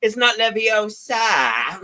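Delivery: high, very slow corrective know-it-all; drawn-out mock lecturing; Hermione Granger. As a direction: correct them pedantically, drawing out the last syllable. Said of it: "Amy's the living embodiment of Hermione Granger".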